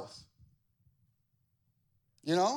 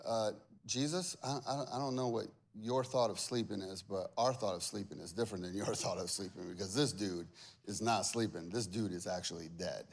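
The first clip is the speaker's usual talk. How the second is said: lightly voiced confused disciples, lower and softer; hesitant, doubting disciple; disciples' voice; 11 semitones lower, 7 dB quieter than usual. Disciples correcting Jesus in a soft, puzzled voice. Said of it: "And they're like".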